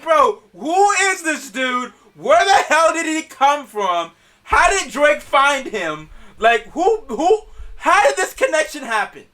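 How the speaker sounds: high-pitched, baffled string of questions; incredulous questioning. Retold or imitated. Asking how [name] found this dude, incredulous questioning. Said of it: "I'm like".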